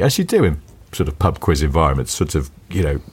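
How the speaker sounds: normal talking voice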